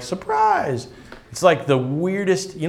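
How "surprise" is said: high, bright mock surprise shout. Preacher playing the swallowed man, mock surprise. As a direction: pop out like a surprise party. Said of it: "you cut the fish open and you're like".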